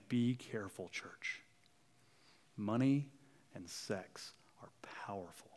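soft, breathy near-whisper of warning; a preacher hushing to warn the church; hushed warning. Real, not acted. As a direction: drop to a whisper to warn them. Preacher warns the congregation hushed.

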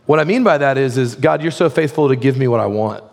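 ordinary talk

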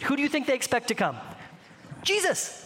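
ordinary delivery

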